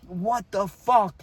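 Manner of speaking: high, drawn-out mock outburst; angry father. Father reacting to his bleeding child, mock angry outburst. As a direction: imitate an alarmed dad's stretched-out exclamation. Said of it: "my angry father".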